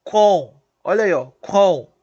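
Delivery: "com" said loud and nasal; loud nasal demo. Shown as a demonstration. Teacher showing the class the nasal "com", loud. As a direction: push "com" out loud through the nose. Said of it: "Então vamos lá".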